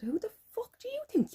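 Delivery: high, outraged retort; indignant mock yell. Retelling her roadside row, high outraged yell. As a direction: fling it back at him, high and indignant. Retold shouting match with driver.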